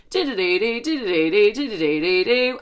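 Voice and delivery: sing-song phone jingle; imitated ringtone. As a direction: mimic a phone ringing in repeated little runs. Imitates her ringing phone.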